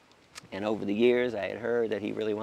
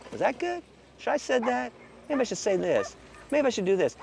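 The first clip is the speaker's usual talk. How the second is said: high, fretful second-guessing; mocking the overthinker, fretful and high; self-doubting; 12 semitones higher than usual. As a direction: wring your hands over every choice.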